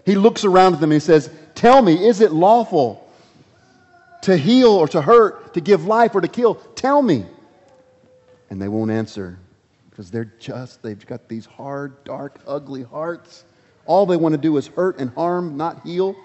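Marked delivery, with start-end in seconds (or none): 1.5-7.2 raised, pressing challenge to the crowd; louder and higher, demanding an answer; challenging demand. Acted. 9.9-13.5 hushed. Acted. Preacher describing hard hearts.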